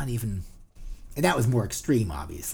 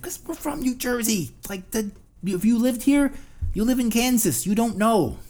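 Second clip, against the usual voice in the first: ranting Jersey reply; 8 semitones higher than usual. Retold or imitated. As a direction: push it hard like you're fed up. Ranting Jersey answer again.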